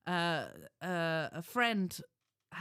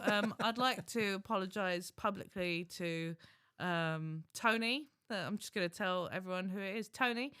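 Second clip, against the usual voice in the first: ordinary talk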